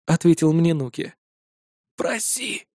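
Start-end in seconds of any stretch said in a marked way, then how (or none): 2.0-2.7 put-on old man's urgent whisper. A narrator's reading. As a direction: whisper it softly as a prompt. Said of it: "прошептал мне старик". (шёпот старика)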